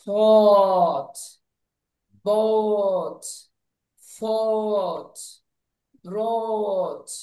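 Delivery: thought, bought, fought, brought each with long "aw"; word by word, stretch the rounded vowel of thought, bought, fought, brought; aw sound demo. Shown as a demonstration. Teacher drills ough words, thought, bought, fought, brought with drawn-out "aw". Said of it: "উচ্চারণটা অ".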